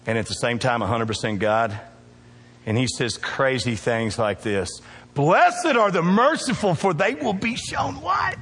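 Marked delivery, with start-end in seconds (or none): 5.1-8.4 high, ringing preacher's call; a call-and-response line for the congregation; preaching scripture. Acted. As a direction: call it out for the church to answer.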